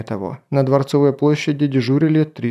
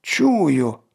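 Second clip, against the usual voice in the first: delighted; 2 semitones higher than usual. Acted. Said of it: "обрадованно воскликнул".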